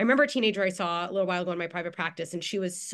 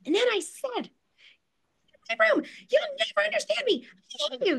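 put-on high, loud teenage screaming-match rant; snarky teen outburst; 5 dB louder than usual. Teen yelling at parents in screaming match, high loud outburst. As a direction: shriek it like a furious teen at her parents. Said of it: "she was really snarky".

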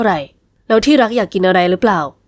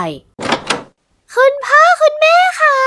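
put-on much higher, slow child's voice calling out; little girl; much slower than usual. Little daughter rushing in to her parents, high child voice. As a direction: call for mum and dad like an eager little girl.

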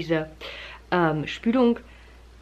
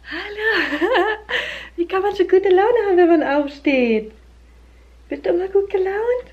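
much higher, sing-song cooing with giggles; 10 semitones higher, 6 dB louder than usual. Real, not acted. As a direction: coo at a little one who just woke up happy. Greeting her just-woken toddler.

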